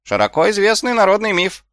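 high, testy insistence; irritated. A narrator's reading. Nobbs insists on the folk myth, irritated. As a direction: insist irritably, pitch jumping up. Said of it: "Голос Шнопса звучал раздражённо".

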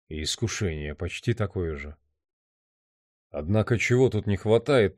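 soft, inward monologue; hushed, pensive musing; brooding man's thoughts. Acted. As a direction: think aloud quietly, searching for a way out.

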